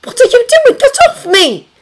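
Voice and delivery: high, forceful hissed outcry; hissed protest. A narrator's reading. Woman fending off an intruder in her bedroom, hissed. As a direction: hiss it out, high and urgent. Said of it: "followed by a hissed".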